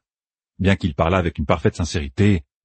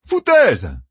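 high, loud dismissive cry; exclaiming; 11 semitones higher, 4 dB louder than usual. A narrator's reading. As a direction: scoff it out loudly. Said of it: "s'écria Matt".

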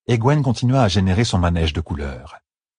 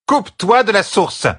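higher, more forceful command; high, pressing order from an exasperated teacher; insistent repeated order; 13 semitones higher, 4 dB louder than usual. A narrator's reading. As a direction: insist hard, pitch right up. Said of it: "répéta Hydrel".

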